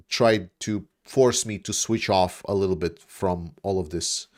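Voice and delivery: usual speaking voice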